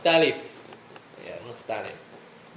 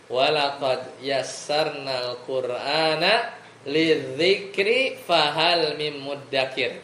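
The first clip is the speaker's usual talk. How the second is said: drawn out, slow chanted recitation; slow Quran recitation; 2 semitones lower, much slower than usual. Shown as a demonstration. Teacher recites the verse slowly for students. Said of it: "udah hafal?".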